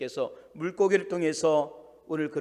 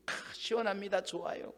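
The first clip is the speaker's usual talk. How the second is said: relieved sigh; 2 semitones higher, 11 dB quieter than usual. Acted. Jonah relieved in the shade.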